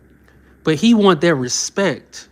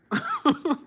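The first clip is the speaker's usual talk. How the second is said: soft high giggle; 13 semitones higher, 8 dB quieter than usual. Real, not acted. Streamer laughing at the clip.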